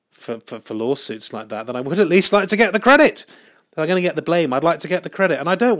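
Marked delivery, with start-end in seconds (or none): 1.7-5.8 loud, high-pitched protest; rising, exasperated insistence; indignant outburst. Real, not acted. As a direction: raise your voice and pitch to demand your due. Demands credit if blamed.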